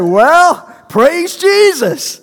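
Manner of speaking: put-on high, loud, slow Kansas accent. The Kansas doctor scolding the young student, in accent. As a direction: stretch it out like the Kansas prophet. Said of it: "in a Kansas accent".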